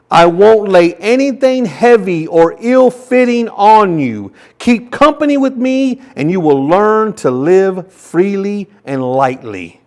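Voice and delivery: fervent slow Bible reading; scripture reading. Shown as a demonstration. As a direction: build the passage with fervour. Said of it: "read this same passage in the Message version".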